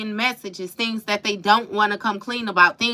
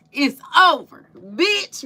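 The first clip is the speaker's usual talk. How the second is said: higher and louder, quoting the movie line; mock yell; 6 semitones higher, 3 dB louder, slower than usual. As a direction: yell it like the Friday breakup line. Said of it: "And he was like".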